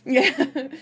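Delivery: high, loud giggly laughter; laughing. Real, not acted. Reader laughs at the worst name possible, giggling. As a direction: let a real laugh break up the word.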